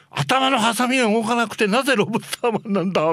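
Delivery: hammed-up high, indignant rant; red-faced outburst. Producer rages about the lobster costume's claws, mock furious. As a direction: demand an answer in exaggerated outrage. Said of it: "ミスター・アレンが真っ赤になって".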